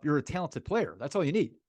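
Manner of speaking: ordinary talk, nothing marked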